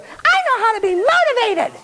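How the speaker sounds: very high-pitched hammed-up squeal; mock over-excitement. Speaker imitates a student walking away motivated, mock excited squeal. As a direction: gush it in a squeaky, put-on voice.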